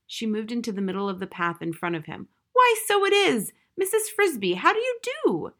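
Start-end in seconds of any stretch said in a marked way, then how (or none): 2.5-5.5 put-on much higher, louder startled greeting from the old mouse; startled, then covering it with politeness. A narrator's reading. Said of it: "but he was startled".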